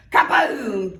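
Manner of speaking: booming sound-effect word; loud kaboom. Acted. Narrator booming the rock's magic blast, loud. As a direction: blast it out like an explosion. Magic rock knocks Lion down.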